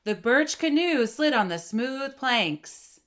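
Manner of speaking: slow, steady air pressure through to the end; slow with full energy. Shown as a demonstration. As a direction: carry the ng energy into the sentence. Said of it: "with that same energy and that same air pressure".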